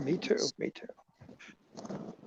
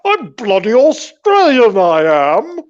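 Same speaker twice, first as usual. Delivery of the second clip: bad Australian accent; 4 semitones higher, 8 dB louder than usual. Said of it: "Australian accent?".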